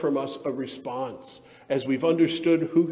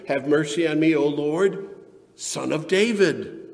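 moderately raised pleading call; crying out; 5 dB louder than usual. Acted. Canaanite woman to Jesus, crying out for mercy. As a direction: plead aloud, stretching the final title. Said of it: "came out and was crying".

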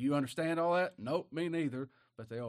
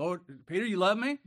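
the Lord pressing; 7 semitones higher, 8 dB louder than usual. Acted. Lord asks again, louder.

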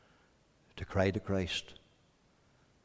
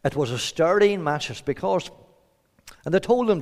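normal talking voice

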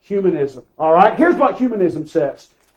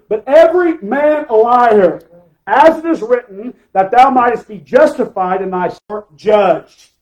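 loud, raised-pitch pulpit reading; forceful Scripture preaching; 4 semitones higher, 6 dB louder than usual. Acted. Preacher reading Romans to the congregation, forceful. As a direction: declaim the verse with full force.

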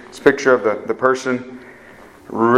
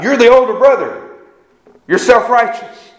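pointed rebuke; 9 semitones higher, 5 dB louder than usual. Acted. Jesus confronting the Pharisees.

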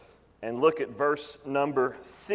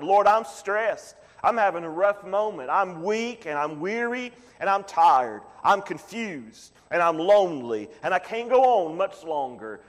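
earnest, higher-pitched prayer of need; pleading prayer; 4 semitones higher than usual. Acted. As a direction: cry out to the Lord like a weary believer. Acted prayer to God.

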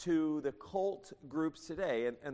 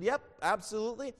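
quick, higher-pitched mock assent; imitating agreeing people; 6 semitones higher, faster than usual. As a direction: play the crowd readily signing on. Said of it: "people said".

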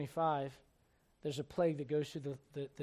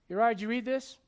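louder, a commander's question; sharp demand; 5 semitones higher, 9 dB louder than usual. Retold or imitated. Joab to Uriah, sharp commanding question. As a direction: challenge him like an officer.

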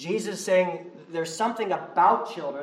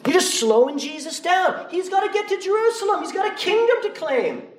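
high, mocking disciple voice; mock scolding; 13 semitones higher, 3 dB louder than usual. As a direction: sneer it like an impatient disciple.

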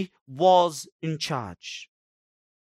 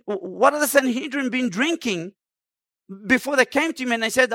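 ordinary talk, nothing marked

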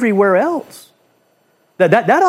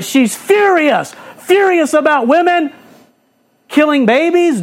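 higher, pushed mock fury; mock outrage; 8 semitones higher than usual. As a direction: parody her anger at babies' expense. Mocking her fury.

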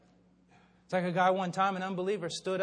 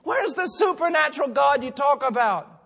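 high, loud challenge to the congregation; impassioned preaching; 9 semitones higher, 13 dB louder than usual. Real, not acted. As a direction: fire off indignant rhetorical questions. Preaches at full force.

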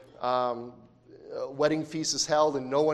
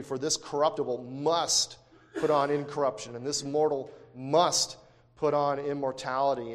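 "must" stressed, with a beat around it; emphasis on must. Real, not acted. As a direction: lean on each "must" in the verse. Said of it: "I emphasize the word must there".

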